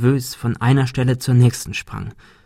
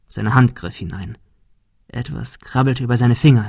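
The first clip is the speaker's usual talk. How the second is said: ordinary delivery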